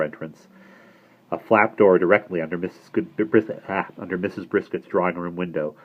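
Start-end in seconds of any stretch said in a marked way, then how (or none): none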